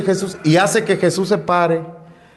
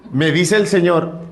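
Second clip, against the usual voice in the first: ordinary talk, nothing marked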